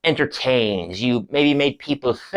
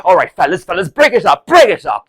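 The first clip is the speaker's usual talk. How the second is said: loud, raised-pitch intervention; breaking up a fight; 5 semitones higher, 6 dB louder, faster than usual. Acted. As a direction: call out urgently over squabbling men. Performer breaks up the quarrel.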